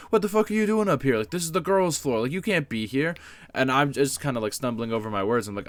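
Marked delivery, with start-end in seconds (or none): 0.1-3.2 a much higher, louder angry outburst; getting mad. Retold or imitated. Band teacher scolding a student on the girls' floor, angry outburst. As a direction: blow up at the kid caught where he shouldn't be. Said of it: "he kinda gets more mad".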